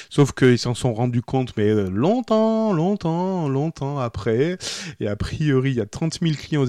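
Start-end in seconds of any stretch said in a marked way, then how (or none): 1.9-4.6 slow, stretched longtemps; drawn out. Acted. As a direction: drag out each longtemps. Drawn out for emphasis.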